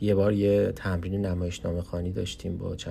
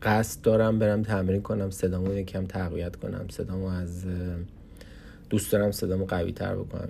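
usual speaking voice

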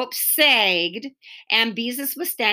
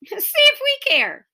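put-on jeering kid; 3 dB louder than usual. Boys jeering, much higher.

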